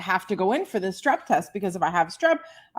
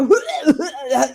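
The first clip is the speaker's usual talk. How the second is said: burst of laughter; 6 semitones higher, 5 dB louder than usual. Real, not acted. Laughing while choking.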